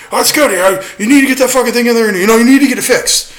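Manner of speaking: high, loud nagging mimic of the boss; mocking the boss. Retelling the boss nagging about the car, high loud mockery. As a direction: lecture in a shrill mocking boss voice. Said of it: "He's like".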